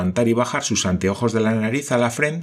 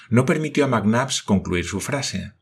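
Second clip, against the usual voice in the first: plain reading voice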